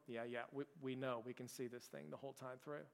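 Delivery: low, soft imitation of unbothered reassurance; calm assurance. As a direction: murmur it aside, well under the complaints. Couple's complaints met with calm assurance.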